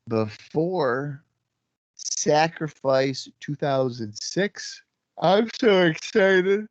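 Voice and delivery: slow words stretched by a yawn. Real, not acted. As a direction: talk through a yawn. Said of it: "I'm yawning in the intro".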